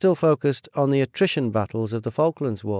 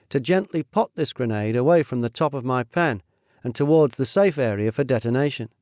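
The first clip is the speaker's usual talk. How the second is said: usual speaking voice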